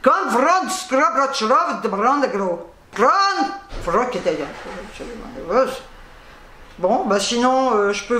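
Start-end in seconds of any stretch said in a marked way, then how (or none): 0.0-4.4 make-believe Russian read off a product label; mock Russian reading. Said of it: "je vais vous le lire en russe".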